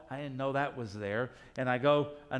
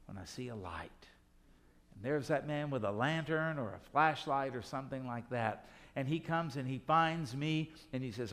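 ordinary delivery

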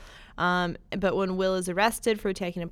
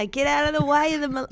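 put-on slipping into an Australian accent, higher and louder; failed Boston accent; 8 semitones higher, 6 dB louder, faster than usual. Host attempting Boston, accidentally Australian accent. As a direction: try Boston, land in Australia. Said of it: "I went Australian".

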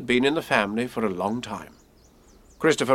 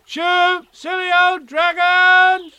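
high, loud, drawn-out shout to scare; child yelling at dragons; 8 dB louder, much slower than usual. Acted. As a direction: shoo the dragons away at full voice. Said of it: "and I shouted".

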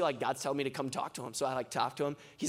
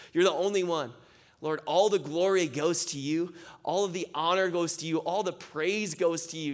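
fervent prayer building higher and louder, then settling; heartfelt prayer; 4 semitones higher, 4 dB louder than usual. Acted. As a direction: pray aloud with rising passion, then calm to amen. Closing prayer over the group.

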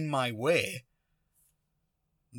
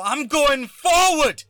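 slow, very loud high bellow; re-enacted angry shout; 14 semitones higher, 10 dB louder, slower than usual. Driver refusing to back up, re-enacted furious bellow. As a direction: bellow each word in defiance. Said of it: "And then I get angry".

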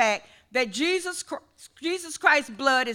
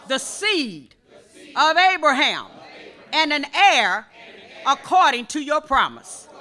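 fervent prayer; 2 semitones higher, 7 dB louder than usual. Real, not acted. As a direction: pray it out to the whole church. Praying aloud in church.

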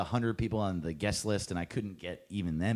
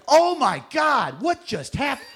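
high, loud mock berating, slow start; exasperated scolding; 10 dB louder than usual. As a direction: scold him in disbelief. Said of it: "he's like".

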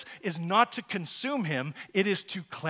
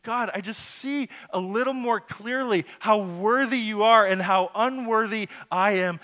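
raised, louder praying voice; fervent prayer; 5 semitones higher, 8 dB louder than usual. Acted. Isaiah praying to God on seeing His worth, fervent. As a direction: pray it out with rising force. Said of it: "He just prays".

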